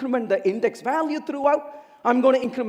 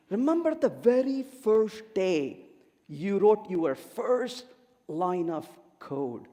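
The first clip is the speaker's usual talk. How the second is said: slow, softer, drawing them into a memory; reflective recall; 4 semitones lower, 6 dB quieter, slower than usual. Real, not acted. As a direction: ease down and let them picture it. Asks audience to think back.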